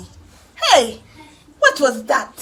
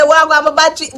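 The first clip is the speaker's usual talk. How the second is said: louder, mock Jamaican Creole slang; imitating Jamaican Creole; 4 semitones higher, 11 dB louder, faster than usual. Teasing a friend by copying her Jamaican Creole. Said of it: "like how me talk".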